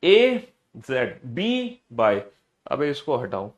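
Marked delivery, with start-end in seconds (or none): 0.0-2.2 very slow, stretched letter chant; slow lead-along drill; recitation demo. Shown as a demonstration. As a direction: draw out each letter for pupils to copy. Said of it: "जैसे मैंने सुनाया वैसे ही सुनाना है".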